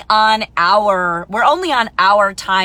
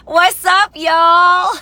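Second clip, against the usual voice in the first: loud, high Texan shout-out; Texan accent; 9 semitones higher, 7 dB louder, much slower than usual. Acted. Newcomer to Texas hailing friends, playful Texan accent. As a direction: call it out in a hammed-up Texan drawl. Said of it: "that's what they sound like".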